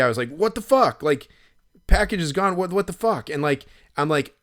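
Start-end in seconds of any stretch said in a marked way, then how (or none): 0.3-3.3 higher, louder exasperated outburst; mock furious what the fuck; retold angry disbelief. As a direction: blurt it out like the package just vanished. Retold shock at stolen package.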